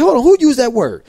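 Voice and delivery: high and loud demand; sharp call out. Retold or imitated. Halting a class after hearing the slur, loud and sharp. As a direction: stop the room cold.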